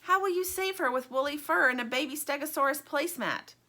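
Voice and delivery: a worried toy sceptic; Stingray asking. Acted. Stingray questions the buffalo's rescue plan, doubtful. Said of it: "asks Stingray".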